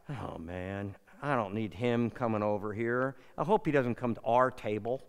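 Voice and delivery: low, soft grumble to his wife; hushed, low retold complaint; grumbled aside. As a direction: grumble it under your breath, dreading company.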